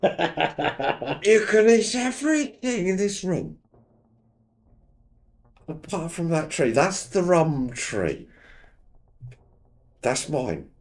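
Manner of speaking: hammy rock star put-on voice; [name] impression. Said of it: "he's doing a bad [name] impersonation".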